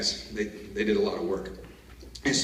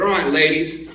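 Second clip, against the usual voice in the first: loud, higher-pitched address to the troops; chest-puffed pep talk; 6 semitones higher, 11 dB louder than usual. Retold or imitated. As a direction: re-enact firing up the troops, chest out. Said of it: "puff my chest out".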